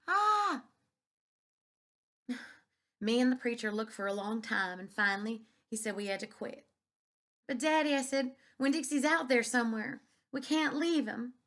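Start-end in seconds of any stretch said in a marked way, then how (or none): 0.1-0.6 high loud sobbing cry; real sob. Real, not acted. Reader overcome while reading, a high sobbing cry. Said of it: "made me cry even more". 7.5-11.3 high, loud, tearful plea; pleading child. A narrator's reading. Daughter begging her father to keep searching, high and loud.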